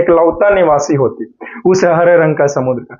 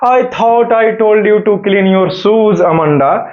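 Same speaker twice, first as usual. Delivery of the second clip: "I thought I told you to clean your shoes" read very slowly; slow reading aloud; much slower than usual. Shown as a demonstration. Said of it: "तो वहीं पूछा जा रहा है". (धीमे-धीमे पढ़कर सुनाना)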